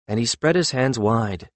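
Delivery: plain reading voice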